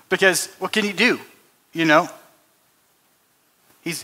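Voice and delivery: ordinary talk, nothing marked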